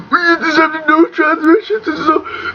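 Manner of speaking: high, strangled plea; choked captive. Acted. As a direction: squeak it out as if held by the throat. Choked captive answers Vader.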